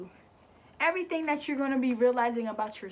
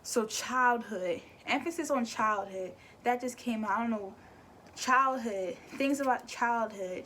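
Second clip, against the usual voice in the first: ordinary delivery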